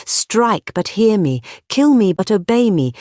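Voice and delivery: high-pitched, punched commands; impassioned plea. A narrator's reading. Gregory begging to be obeyed, impassioned plea. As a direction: hurl each command at them.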